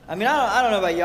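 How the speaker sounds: normal talking voice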